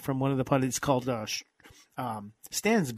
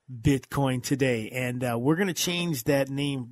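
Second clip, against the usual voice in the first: usual speaking voice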